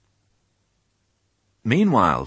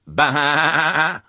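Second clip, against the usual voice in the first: higher, louder bleating sheep; eager bleat; 10 semitones higher, 5 dB louder than usual. A narrator's reading. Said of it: "bleated the sheep eagerly".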